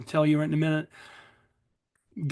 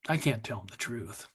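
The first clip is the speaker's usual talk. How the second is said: softer, lower echo; 5 semitones lower, 7 dB quieter than usual. Real, not acted. Admitting to the audience he hid the truth from his daughters, softer. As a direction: repeat it lower and softer, almost to yourself. Confessing hidden vodka to daughters.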